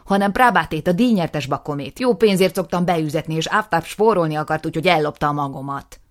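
loud, furious outburst; angry accusation. Acted. Nisim accusing his brother, loud and furious. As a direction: spit out the accusation. Nisim rants about stud.

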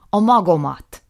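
loud, furious outburst; angry accusation. Acted. Nisim accusing his brother, loud and furious. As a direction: spit out the accusation.

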